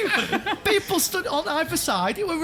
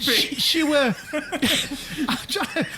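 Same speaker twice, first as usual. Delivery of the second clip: struggling to talk through laughter; words stretched out by laughs; laughing; much slower than usual. Real, not acted. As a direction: try to get the story out while laughing. Helpless giggles while telling story.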